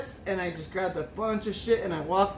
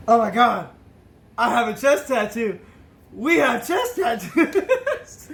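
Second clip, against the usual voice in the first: louder, rising into a slow laughing cry; drunken excitement; 3 semitones higher, 5 dB louder, slower than usual. Retold or imitated. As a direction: blurt it out thrilled like a happy drunk. Drunk excitedly realizes shared tattoo.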